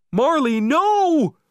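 loud, very high, stretched-out yell; desperate shout. A narrator's reading. Owner yelling at dog in the sea, desperate shout. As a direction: shout to stop your dog, drawing it out. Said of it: "I shouted".

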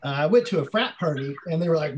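ordinary talk

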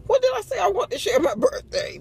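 high-pitched playful indignation; mock indignant. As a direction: act out an incredulous retort, way up high. Acts out indignant reply about birthday.

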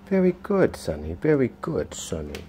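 soft dog praise. Real, not acted.

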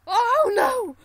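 loud high alarm; startled shout. Real, not acted. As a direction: yelp of alarm. Near miss in game.

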